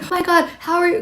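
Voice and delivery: high, loud, flustered gush; a rattled demo of getting triggered; triggered outburst. Shown as a demonstration. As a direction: blurt it out all worked up, the wrong way. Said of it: "not like".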